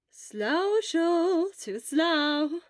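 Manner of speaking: high, loud singsong tease; mock him playfully; teasing. Hisashi teasing slow Shura, playful singsong. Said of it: "Hisashi teased him".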